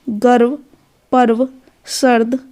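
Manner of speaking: ordinary delivery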